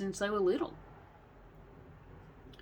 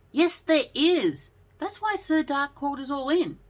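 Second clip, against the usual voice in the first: lady luck tone; 9 semitones higher than usual. A narrator's reading. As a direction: draw the first words out high like a little lady. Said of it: "took her lady luck tone".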